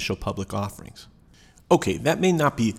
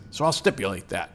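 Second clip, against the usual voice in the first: lightly imitating a gravelly pirate; 2 semitones lower than usual. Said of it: "in a gravelly pirate voice".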